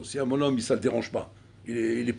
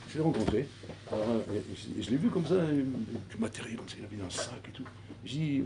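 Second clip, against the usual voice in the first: ordinary talk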